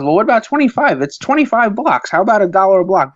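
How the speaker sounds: high-pitched imitation of a pushy kid; haggling smart kid. Today's kid bargaining with mobster over pay, imitated haggling kid. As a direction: haggle like a cheeky child. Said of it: "The kids are way too smart".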